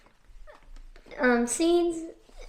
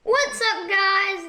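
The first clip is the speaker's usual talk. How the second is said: bright, higher vlog hello; excited greeting; 5 semitones higher, 3 dB louder, slower than usual. Real, not acted. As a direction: pump up the viewers. Kid's channel intro greeting.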